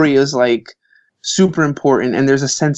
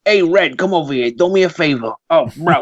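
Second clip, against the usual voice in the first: Brooklyn mafia guy; 4 semitones higher than usual. Retold or imitated. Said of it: "all those mafia guys".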